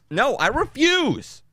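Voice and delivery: high, slow mock-stubborn refusal; playful refusal. Refusing viewers' correction of LaCroix, high and slow in mock defiance.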